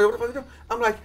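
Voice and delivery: mock-order him back inside; imitating his own pushy friend; retold scolding.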